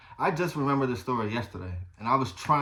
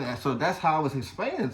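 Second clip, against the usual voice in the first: ordinary talk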